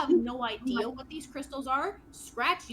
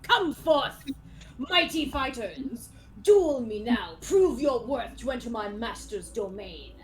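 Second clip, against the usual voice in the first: shouting challenger; 5 dB louder than usual. Acted. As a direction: call out like a proud dueling knight. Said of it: "you hear him start shouting more".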